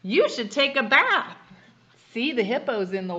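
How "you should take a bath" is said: hippo voice. A narrator's reading. Hippo line read aloud.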